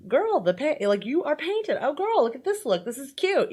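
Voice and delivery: gushing drag queen. Retold or imitated. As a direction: gush over the look like a fan queen. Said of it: "I want her to be like".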